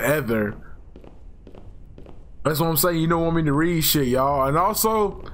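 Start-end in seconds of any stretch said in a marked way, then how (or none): 0.0-0.6 "ever" with th in place of v; mispronounced "ever". Shown as a demonstration. Gamer quoting his own slip, "ever" said as "ether". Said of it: "I said ever".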